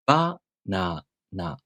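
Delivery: "Banana" drawn out syllable by syllable, high, low, low; Japanese pitch accent. Shown as a demonstration. Teacher showing Japanese pitch accent, slow "Banana" high-low-low. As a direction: slowly spell out "Banana", pitch dropping after Ba. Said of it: "High, low, low".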